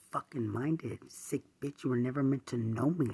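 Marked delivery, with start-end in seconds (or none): 1.8-3.1 furious, loud, hammering each denial; angry shouting. Real, not acted. Ranting at the man she accuses, furious loud shouting. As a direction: yell it straight at the person who wronged you.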